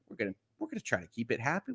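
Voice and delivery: normal talking voice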